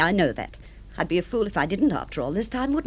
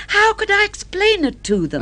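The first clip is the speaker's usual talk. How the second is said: higher and louder, rising in agitation; flustered plea; 10 semitones higher, 5 dB louder than usual. Acted. Wife pleading with husband not to be introduced, flustered and higher. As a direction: pitch it up in a flustered rush.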